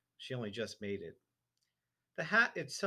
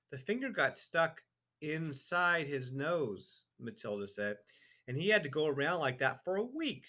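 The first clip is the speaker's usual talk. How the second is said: lightly voiced girl; 6 semitones higher, 6 dB louder than usual. A narrator's reading.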